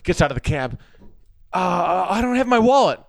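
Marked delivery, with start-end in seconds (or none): 1.5-3.0 mock panic. Panicked actor lacks cab fare.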